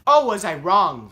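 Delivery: loud, high ironic twist; ironic reveal. Real, not acted. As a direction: land the irony with a punch. Ironic turn in the story.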